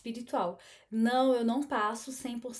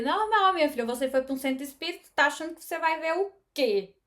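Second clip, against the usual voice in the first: fast, higher mock of a dismissive friend; mocking skeptic; 4 semitones higher, 5 dB louder, faster than usual. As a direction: toss it off like a know-it-all friend. Imitating a skeptical person.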